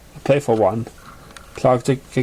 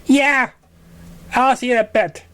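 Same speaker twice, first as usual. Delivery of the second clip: higher, bright little filly voice; young pony voice; 9 semitones higher than usual. A narrator's reading. Dinky answers her sister as a higher little filly. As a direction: play the excited little sister.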